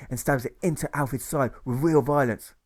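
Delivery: ordinary delivery, nothing marked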